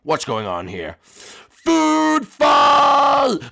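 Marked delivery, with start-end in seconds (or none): 1.0-3.4 high, loud shouted war cry; high, loud, gleeful shout; battle cry. Acted. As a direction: yell it like launching an attack. Starting the food fight.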